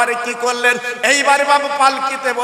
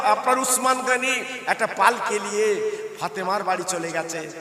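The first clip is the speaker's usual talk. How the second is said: ordinary talk, nothing marked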